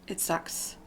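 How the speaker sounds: whispered, voice giving out; a quiet, broken whisper of grief; choked-up whisper. Real, not acted. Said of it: "Sorry, I get a little emotional".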